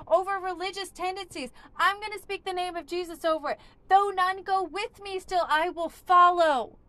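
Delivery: loud, high, driving sermon voice; full-force preaching. Acted. Preacher rallying people out of addiction, loud and high. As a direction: preach it out with fire to the room.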